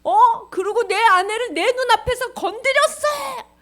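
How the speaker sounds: high, loud royal outrage; angry shouting. Acted. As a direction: shout it slowly, scandalised and furious. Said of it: "화를 소리 소리 지르니까". (화나서 소리치는 임금)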